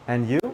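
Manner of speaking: "And you?" rising sharply on "you"; questioning intonation. Shown as a demonstration. Teacher showing learners the intonation, "And you?" rising on "you". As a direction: lift "you" at the end of "And you?". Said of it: "the intonation is important".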